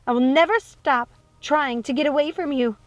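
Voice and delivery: high, loud defiant cry; defiant outburst. Acted. Rapunzel refuses Gothel as she is dragged away, defiant. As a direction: fight back with every word.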